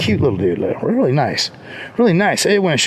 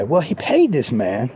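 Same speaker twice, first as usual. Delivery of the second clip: ordinary talk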